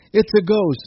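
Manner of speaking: it's a ghost yelled in fright; frightened cry. A narrator's reading. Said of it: "cried out in fear".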